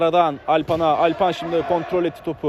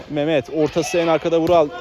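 ordinary talk, nothing marked

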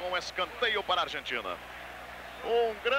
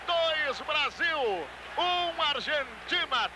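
excited score call; 8 semitones higher, much slower than usual. Real, not acted. Scoreline called after goal.

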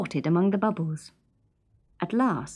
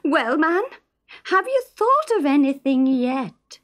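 higher and louder, impatient wife; Isa's pressing voice; 8 semitones higher, 4 dB louder than usual. Acted. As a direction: nag him again, sharper now. Isa presses husband at morning.

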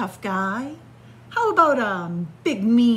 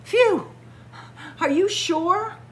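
nervous relief; 11 semitones higher than usual. Acted. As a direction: sigh of relief, still unsure. Shark relieved but nervous.

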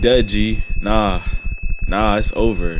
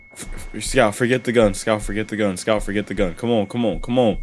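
urgent muttering; 9 semitones lower, 5 dB quieter than usual. Real, not acted. Urging wounded Scout under his breath.

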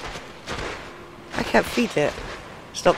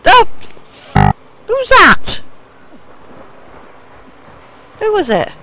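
startled; 13 semitones higher, 11 dB louder than usual. Real, not acted. Startled by unseen creature.

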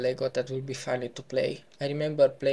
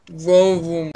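car vroom; 2 semitones higher, 9 dB louder than usual. Pretend. Imitating car noise.

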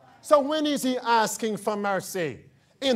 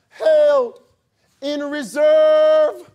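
loud preaching; 5 semitones higher, 9 dB louder, much slower than usual. Real, not acted. Preacher building to climax.

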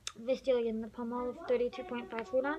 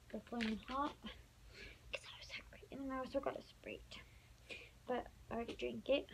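soft unvoiced whisper; 5 dB quieter than usual. Real, not acted. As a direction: whisper it, barely audible. Whispers haul items quietly.

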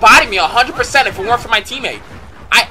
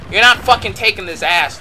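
usual speaking voice

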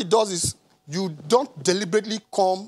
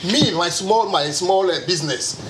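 usual speaking voice

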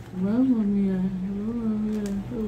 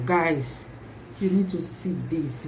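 normal talking voice

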